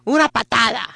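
"Una patada" said in Spanish, loud and punchy; Spanish word. Shown as a demonstration. Part-Mexican speaker saying "Una patada" proudly in Spanish. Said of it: "I have to say it in Spanish".

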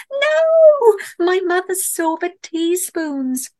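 high, drawn-out wail of dismay; horrified cry. A narrator's reading. Camper watching the bear walk off with her teaspoons, horrified cry. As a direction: cry out loud and high, stretching the words. Narrator cries out at theft.

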